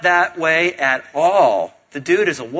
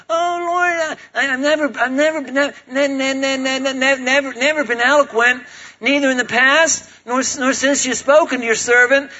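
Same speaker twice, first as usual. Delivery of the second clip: much higher, halting stutter on every start; stammering nervous Moses; 9 semitones higher than usual. Retold or imitated. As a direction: sputter it out like a jittery neurotic. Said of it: "Woody Allen or Rain Man".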